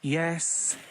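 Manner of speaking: a short sighed Yes; lightly sighed Yes; mild sigh. A narrator's reading. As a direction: breathe out a weary Yes. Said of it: "he sighs".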